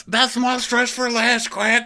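high hammed punchline. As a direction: sell the joke high. Joke punchline.

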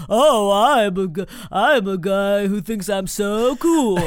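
slow, dopey douchey guy voice; voice for exes. Retold or imitated. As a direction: drag it out like a smug bro. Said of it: "the voice I do if I'm talking about a guy".

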